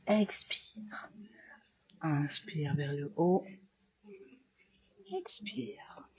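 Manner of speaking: soft whisper, barely voiced; whispered breath cues. Teacher whispering breath cues as students flow.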